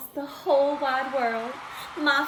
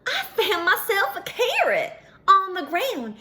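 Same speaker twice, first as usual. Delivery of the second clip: ordinary delivery, nothing marked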